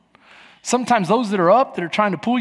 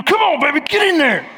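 pep-talk shout; 6 semitones higher than usual. Retold or imitated. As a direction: push them on like a coach. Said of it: "I'm gonna come up behind you".

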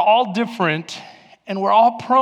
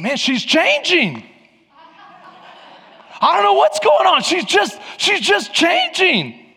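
puzzled husband; 8 semitones higher than usual. Retold or imitated. Husband retold, puzzled about wife.

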